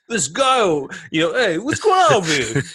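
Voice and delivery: put-on high, loud New Jersey accent. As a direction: greet like a Jersey guy. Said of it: "my New Jersey accent".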